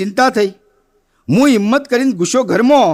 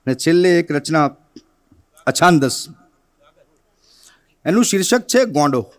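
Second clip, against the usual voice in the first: ordinary delivery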